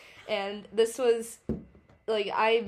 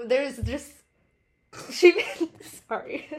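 laughing; 5 semitones higher, 7 dB louder than usual. Real, not acted. Laughing through the funny story.

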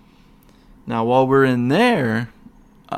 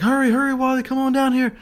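much higher, urgent imitated hurry; urgent calling; 13 semitones higher, 3 dB louder, faster than usual. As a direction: mimic a frantic friend calling you over. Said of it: "Justin's like".

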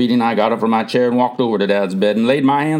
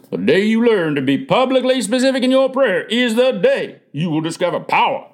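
lightly voiced grandfather, higher pitched; grandfather's promise; 8 semitones higher than usual. A narrator's reading. Grandfather making a promise to his grandson, lightly voiced. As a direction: pronounce it like a solemn promise. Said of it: "I remembered Grandfather's promise".

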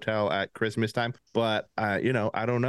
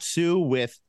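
normal talking voice

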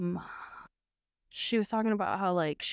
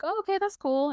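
mock chirpy reply; 10 semitones higher than usual. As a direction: chirp it like you're pretending it's fine. Hairstylist voiced reacting to date.

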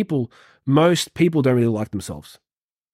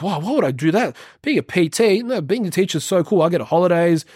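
lightly voiced, higher-pitched teacher; mock dismissive teacher; 5 semitones higher than usual. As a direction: scoff lightly as a doubting colleague. Mimics a dismissive fellow teacher.

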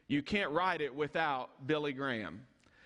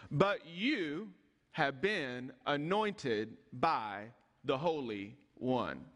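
slow emphatic repeat; 4 semitones lower, much slower than usual. Shown as a demonstration. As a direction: lower and very slow, weighing each word.

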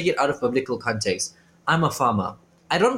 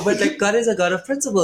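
ordinary talk, nothing marked

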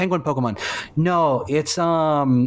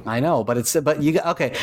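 ordinary talk, nothing marked

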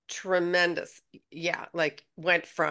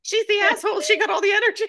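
high, loud, laughing protest; hammed-up grievance, laughing; laughing mock outrage; 8 dB louder, faster than usual. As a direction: squeal it through laughter in mock indignation. Joins the joke, high, laughing.

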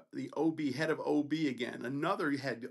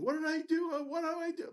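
high-pitched imitation of blubbering; mock crying; 13 semitones higher than usual. Cornered resident pleading with the student, imitated crying. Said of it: "literally crying".

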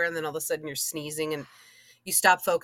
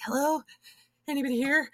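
timid check; 8 semitones higher than usual. Retold or imitated. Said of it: "You're like".

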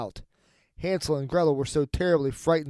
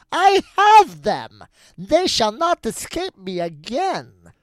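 high, loud, drawn-out witch gloat; mocking witch; 6 semitones higher, 8 dB louder, much slower than usual. A narrator's reading. Witch gloats over Hansel and Gretel, mocking and high. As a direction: crow it slowly and very high. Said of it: "said mockingly".

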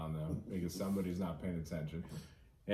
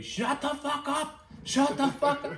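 furious shout; 7 dB louder than usual. Retold or imitated. Coworker screaming skyward after a shouting match at work, high furious yell. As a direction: lose your mind and yell it to the heavens. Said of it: "he's losing his fucking mind".

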